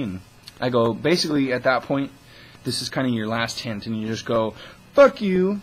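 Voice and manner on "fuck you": higher, louder fuck you; mock yell. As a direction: bark fuck you at the scammer. Telling off the scammer.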